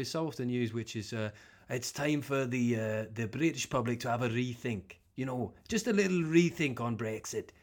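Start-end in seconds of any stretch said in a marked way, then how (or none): 1.7-7.5 lightly imitated Welsh lilt on the rethink line; mimicking a Welsh politician, a hint of Welsh; mock Welsh accent. Comic mimics Welsh MP Owen Smith in a light Welsh accent. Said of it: "taking a piss out of that accent".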